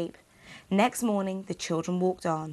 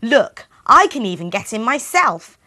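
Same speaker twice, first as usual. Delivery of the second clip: angry witch; 3 semitones higher, 9 dB louder than usual. Acted. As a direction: snap at the silly girl. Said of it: "the witch said angrily".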